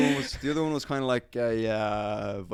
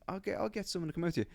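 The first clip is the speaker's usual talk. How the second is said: quick, high, soft American auntie; imitated American accent, hushed and high; 7 semitones higher, 8 dB quieter, faster than usual. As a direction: hurry out an offer in a mock American accent. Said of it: "giving her an American accent".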